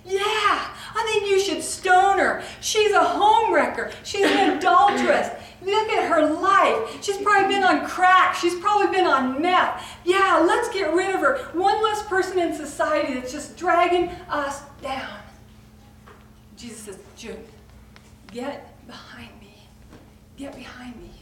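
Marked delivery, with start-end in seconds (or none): 0.0-15.2 sneering accuser calling for her death; judgmental self. Retold or imitated. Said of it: "I'm seeing myself go". 17.2-21.1 soft, hushed correction; Jesus quietly telling her to step back; whispered rebuke. Acted.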